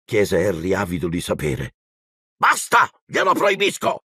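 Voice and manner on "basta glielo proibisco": very high, frantic shout; high-pitched desperate outburst; Zio Vernon, panicked cry. A narrator's reading. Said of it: "gridò zio Vernon in preda al panico".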